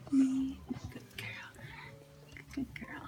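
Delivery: slow, soft reassuring whisper; calm the mother dog gently; tender hushed praise; soothing whisper. Real, not acted.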